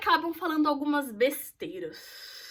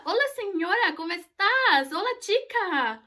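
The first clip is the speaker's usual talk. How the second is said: higher, louder imitation of a joker saying hola; mock Spanish greeting; 5 semitones higher, 4 dB louder, slower than usual. Wise guy greeting a Brazilian, mocking in Spanish. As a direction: tease like a smug guy chatting her up. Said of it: "tem um engraçadinho que começa".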